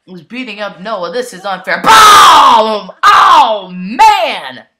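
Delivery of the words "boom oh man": excited shout. Real, not acted. Big move lands in match.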